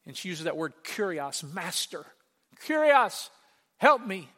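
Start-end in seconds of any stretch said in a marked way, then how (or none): 2.5-4.3 loud, high acted cry for help; begging. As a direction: call on the master, imitating her plea. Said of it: "There's that beggar term".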